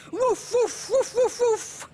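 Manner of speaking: high barked woofs; dog barking. Acted. The brave dog calling from the boat, barking high. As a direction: bark as loud as a big dog. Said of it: "barking as loud as he could".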